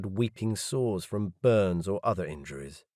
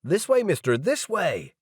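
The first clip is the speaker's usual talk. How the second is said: much higher, loud calling out; yelled; 10 semitones higher, 3 dB louder than usual. Acted. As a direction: call them over across the hall, loud and high. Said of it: "Someone yelled".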